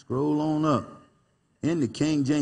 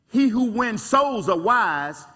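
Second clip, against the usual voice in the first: said out loud; 9 semitones higher, 4 dB louder, slower than usual. Retold or imitated. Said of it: "she says out loud".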